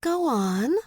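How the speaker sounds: put-on high, smiling old-money politeness; bright gracious go on; polished polite. A narrator's reading. Nell soothing the lion with a society smile, polite. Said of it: "award-winning smile and ingrained old money politeness".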